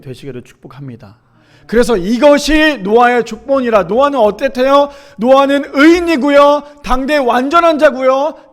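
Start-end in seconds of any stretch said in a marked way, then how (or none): 1.7-8.5 loud, high, stretched key phrases; ringing, measured proclamation; forceful preaching. Real, not acted. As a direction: declare it emphatically from the pulpit.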